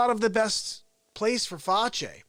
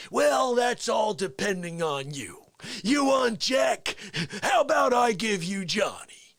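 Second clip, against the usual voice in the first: Jack Nicholson. Acted. Taking a request, then playing the courtroom scene, menacing star impression. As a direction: lean on each challenge, then push it harder. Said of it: "how about you do some Jack Nicholson".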